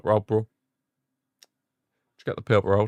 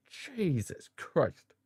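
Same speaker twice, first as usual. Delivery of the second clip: exasperated groan; 7 semitones higher, 12 dB quieter, much slower than usual. Real, not acted. Exasperated at the referee.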